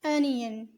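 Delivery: अनियन with an open a, not ओनियन; clear pronunciation. Shown as a demonstration. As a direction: say अनियन starting with a. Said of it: "इसे ओनियन मत कहिएगा". (अनियन को "अ" से बोलना, ओनियन नहीं)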